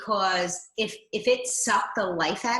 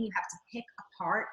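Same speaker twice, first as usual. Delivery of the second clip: whisper; 5 dB quieter, much faster than usual. Real, not acted. Whispered emphasis in advice.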